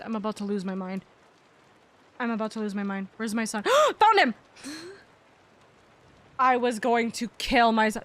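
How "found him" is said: gasp of relief. Real, not acted. Finds lost toy.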